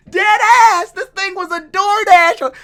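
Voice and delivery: loud, high-pitched disbelief; excited high-pitched exclamation; amused outburst. Real, not acted. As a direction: blurt it out high and loud, tickled by the joke. Amused outburst over a joke.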